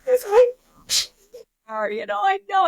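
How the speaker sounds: high-pitched mock crying while retelling; imitated crying. Wife crying as her husband searches for the elk, imitated sobbing. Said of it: "I'm crying".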